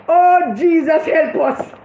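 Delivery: ordinary talk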